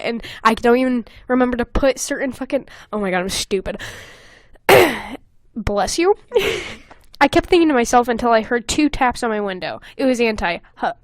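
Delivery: ordinary delivery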